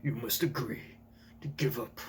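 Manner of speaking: put-on low, quiet, sinister old man; villainous old man voice. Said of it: "That villainous old man voice I'm looking for".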